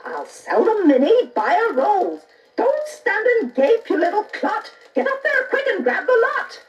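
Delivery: high, gleeful shrieking mother; the mother spots the golden leaves and yells at Jack; greedy mother yelling. A narrator's reading. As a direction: cackle and yell at the boy. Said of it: "She yells out loud".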